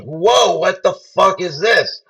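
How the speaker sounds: retold freaked-out reaction. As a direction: act out a startled churchgoer's disbelief. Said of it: "People are freaked out".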